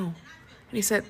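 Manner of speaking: ordinary talk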